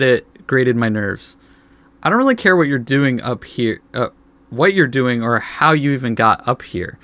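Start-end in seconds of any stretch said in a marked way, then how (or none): none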